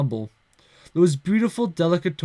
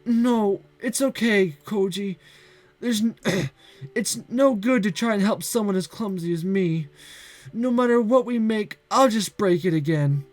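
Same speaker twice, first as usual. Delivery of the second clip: resigned; 6 semitones higher than usual. Acted. Girl Santa, giving up.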